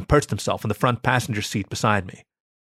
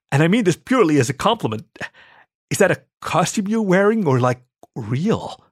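high, tentative guard's question; higher-voiced, hesitant fascination; awestruck curiosity; 7 semitones higher, 3 dB louder than usual. A narrator's reading. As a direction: rush the disclaimer, then ask gingerly in wonder. Awed guard asks delicate question.

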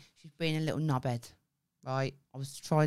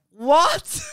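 high, loud startled "What?"; shocked what; 9 semitones higher, 12 dB louder than usual. Retold or imitated. Mum hears her daughter has Play-Doh in the car, shocked. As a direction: jolt up in disbelief. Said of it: "And I went".

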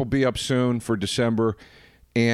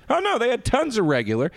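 much higher-pitched imitation of a friend; oblivious answer; 10 semitones higher than usual. Host mimicking Pat's answer about regular juice, oblivious. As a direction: imitate a blithe, clueless reply, pitched up.

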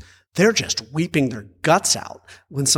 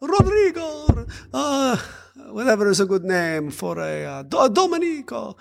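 very high, drawn-out wail of a name; overplayed movie grief, pitched way up; hammed-up Italian lament; mock Italian mourner; 11 semitones higher, slower than usual. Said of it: "you always see it in the movie".

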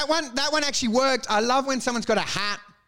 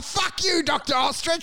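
high jeering insult; mock yell; 7 semitones higher than usual. Passer-by heckling Doctor Ostrich from a car, high mock yell. People throwing eggs from car.

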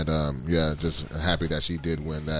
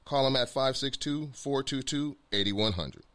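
ad read; 3 semitones higher, 5 dB quieter than usual. Acted. Reads a sponsor ad.

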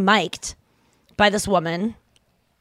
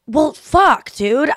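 exasperated; 7 semitones higher, 6 dB louder, much slower than usual. Retold or imitated. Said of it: "So I was like".

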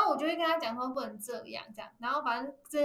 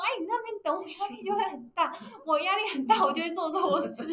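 a hammed-up, higher husband voice; half-laughing impression of him fretting; mock whiny husband; 6 semitones higher, 4 dB louder than usual. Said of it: "然后他就说什么：".

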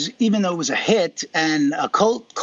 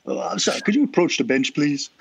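mock judge. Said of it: "Fred Gwynn".

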